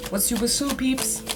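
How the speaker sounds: high, teasing question to viewers; high playful question. Real, not acted. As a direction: pitch it way up playfully.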